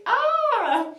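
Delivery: high, loud mock squeal; imitated crowd scream. Everyone's reaction imitated.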